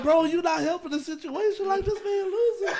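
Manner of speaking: high, loud retold pleading; acted exasperated protest. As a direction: plead with a bandmate over the mess. Re-enacts scolding a bandmate.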